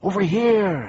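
high, loud beckoning call; calling advice. A narrator's reading. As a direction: call out to draw them over. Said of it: "advised the voice".

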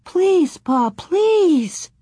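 put-on very high, urgent childish plea; begging. A narrator's reading. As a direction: plead desperately like a little girl. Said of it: "Laura begged".

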